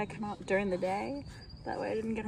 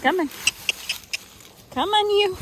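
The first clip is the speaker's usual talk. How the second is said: calling out; 10 semitones higher, 7 dB louder than usual. Real, not acted. Calling horse.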